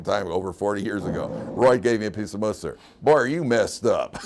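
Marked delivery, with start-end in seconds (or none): 3.0-4.2 louder, slow, laughing jibe; amused mockery. Trucker ribs a lost traveler, amused mocking. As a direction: tease with a slow, amused relish. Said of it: "he was just so amused".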